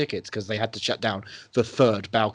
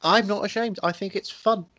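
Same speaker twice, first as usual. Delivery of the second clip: high excited defence; 9 semitones higher than usual. Real, not acted. Defends liking Bloodshot.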